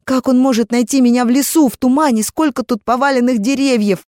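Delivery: high desperate voice; panicked plea. Acted. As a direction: fear of being left. Malta panics about being lost.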